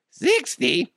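squeaky, very high cracked voice; mock voice crack. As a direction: mimic your own voice breaking on "sixty". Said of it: "My voice cracked".

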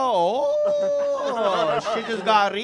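high, long-held "Oh" into punchline; mock alarm. Homeless son delivers the catchphrase, mock alarm. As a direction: wail the realisation comically. Punchline after being thrown out.

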